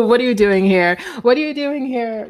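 loud, hammed-up challenge; mock confrontation. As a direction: challenge an uninvited guest in mock outrage. Acts planets confronting an unwelcome guest.

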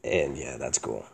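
very low, quiet, uninterested delivery; monotone. Shown as a demonstration. Showing how he really talks, low monotone. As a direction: sink the voice right down, no emotion. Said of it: "I talk like that".

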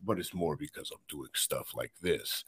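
deep, dramatic trailer narrator; low booming trailer announcer; movie trailer voice. Shown as a demonstration. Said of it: "good movie trailer voice".

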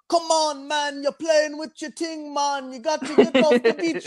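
put-on Jamaican accent yell. Lifeguard chasing a man off the beach, Jamaican accent yelling. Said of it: "the fucking Jamaican lifeguard goes over to him and starts yelling".

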